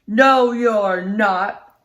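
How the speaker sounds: loud, slow troll growl; troll refusing the little goat, growling. Acted. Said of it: "growled the troll".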